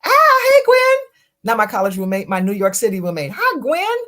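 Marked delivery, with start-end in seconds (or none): 0.0-1.0 high, loud burst of recognition; light up at a friend's name; delighted surprise. Real, not acted. Recognises her old roommate's name. 3.4-4.0 high, lilting hello to a friend; cheery high hi to roommate; sing-song greeting. Real, not acted. Greets old roommate on stream.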